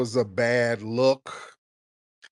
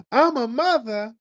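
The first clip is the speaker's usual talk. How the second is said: high-pitched mock quote of a singer; 11 semitones higher, 3 dB louder than usual. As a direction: mimic a star's earnest declaration, pitched way up. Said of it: "[name] didn't really say anything profound".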